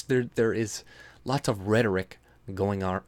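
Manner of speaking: ordinary talk, nothing marked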